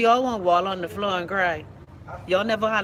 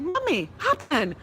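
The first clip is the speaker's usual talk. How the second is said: small son's voice; 8 semitones higher, slower than usual. Retold or imitated. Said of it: "And he's like".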